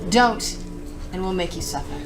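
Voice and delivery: ordinary talk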